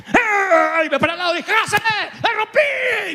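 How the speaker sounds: high, shouted outrage, retold with a laugh; angry yell. Preacher retelling shouting at the trucker, high angry yell. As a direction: yell up high at the trucker who cracked the glass. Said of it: "Me enojé tanto".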